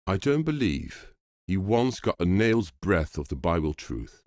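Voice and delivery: ordinary delivery, nothing marked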